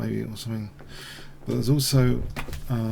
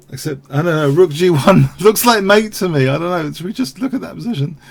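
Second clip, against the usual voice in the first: laughing; 5 semitones higher, 8 dB louder than usual. Real, not acted. Laughing about inevitable mate.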